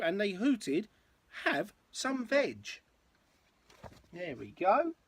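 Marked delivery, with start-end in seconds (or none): 0.0-0.9 lilting, rhythmic picture-book read; story narration. A narrator's reading. Parent reading a dinosaur rhyme to a child, story narration. 1.4-2.8 higher, slow sing-song offer; mild hooting. A narrator's reading. Duckbills welcome the newcomer to eat, mild hooting.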